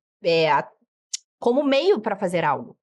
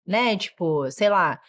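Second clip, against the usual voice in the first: normal talking voice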